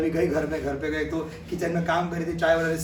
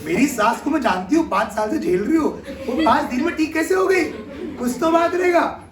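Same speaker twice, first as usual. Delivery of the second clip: much higher, louder disbelieving protest; insistent bahu; 9 semitones higher, 4 dB louder than usual. Retold or imitated. Bahu insisting the baba explain the secret, much higher and louder. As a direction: refuse to leave until he tells.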